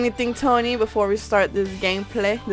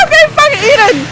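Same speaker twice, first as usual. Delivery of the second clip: fast, high-pitched panic; panicked yell; 11 semitones higher, faster than usual. Real, not acted. Gamer being eaten in the game, panicked fast yell. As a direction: blurt it out fast and high under attack.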